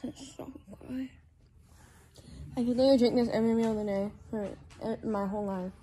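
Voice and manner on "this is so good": soft, quick whisper. Real, not acted. Whispering to the camera over her milkshake. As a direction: whisper your delight.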